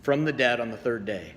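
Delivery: measured, solemn liturgical reading; gospel reading. Acted. Pastor reading the gospel to the congregation, solemn and measured. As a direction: proclaim the scripture to the congregation. Reads the gospel lesson aloud.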